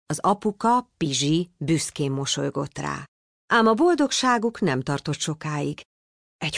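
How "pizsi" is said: plain reading voice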